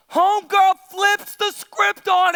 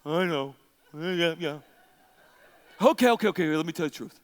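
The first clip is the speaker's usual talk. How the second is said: low, soft, placating give-in; mock sheepish, low and subdued; Samson; 11 semitones lower, 5 dB quieter, much faster than usual. Preacher plays Samson answering Delilah, low and sheepish. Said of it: "what's his response?".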